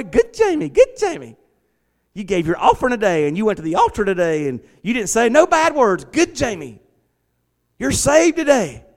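high, sing-song mock praise of a good boy; mocking pat on head. Pastor mocking the idea God saves good boys, high sing-song.

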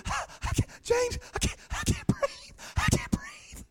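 high, squeaky gasping through laughter; laughing too hard to breathe. Retold or imitated. Preacher imitating someone breathless from laughing. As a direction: wheeze out the words between laughs. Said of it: "She's laughing so hard".

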